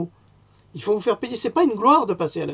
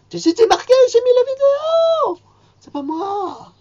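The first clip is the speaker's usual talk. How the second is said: much higher, louder falsetto squeal; squeaky mock denial; 9 dB louder than usual. Mocking Macron's panicked denial in a squeaky falsetto. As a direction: squeal it like a caught child.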